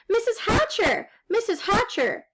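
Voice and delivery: put-on high, eager girl's call. A narrator's reading. Girl to her friend's mother, calling out high. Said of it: "Sheila called".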